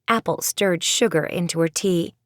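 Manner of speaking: ordinary delivery, nothing marked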